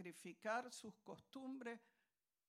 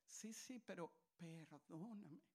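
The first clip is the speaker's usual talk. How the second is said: much softer pleading perdóname; soft apology; 4 semitones lower, 9 dB quieter than usual. Retold or imitated. Preacher playing himself answering his sister, soft plea. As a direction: hush it, sorry and tender. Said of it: "decir ella".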